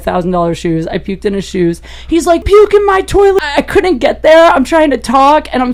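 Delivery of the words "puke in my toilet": high, loud urgent command; exasperated order. Retold or imitated. Annoyed host ordering the vomiting guest, loud. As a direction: bark it at her to get to the toilet. Guy ordering her to toilet.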